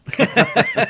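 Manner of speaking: short high tired laugh; weary laugh. Real, not acted. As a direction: laugh it off, worn out. Tired coach laughs after "I'm tired.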